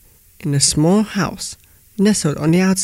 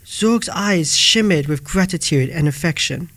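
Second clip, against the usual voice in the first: ordinary delivery, nothing marked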